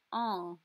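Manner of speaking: "un" as one nasal vowel. Shown as a demonstration. Teacher showing students the nasal vowel. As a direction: hum "un" through the nose as one sound. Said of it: "is a nasal sound".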